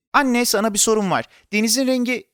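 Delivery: put-on high, eager child voice; insistent child. A narrator's reading. Boy trying to ask his mother a question, insistent. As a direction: pipe up to get mum's attention.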